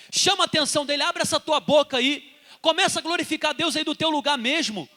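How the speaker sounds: loud, high-pitched exhortation; fervent preaching. Real, not acted. Preacher urging church to open their mouths, fervent shout. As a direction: urge the congregation at full force.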